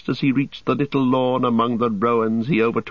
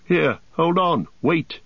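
high, urgent call to halt; pitched up and pressing; crying out; 8 semitones higher than usual. A narrator's reading. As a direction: call after people dashing off. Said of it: "cried Aragorn".

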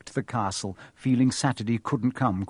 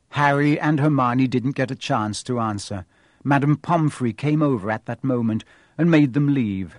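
ordinary delivery, nothing marked

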